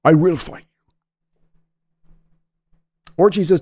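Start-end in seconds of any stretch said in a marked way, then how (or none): 0.0-0.7 put-on Liam Neeson slipping into a Sean Connery accent; a light Scottish tinge, Sean Connery style; Liam Neeson impression. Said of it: "when I try to do Liam Neeson, it becomes Sh-, Sean Connery".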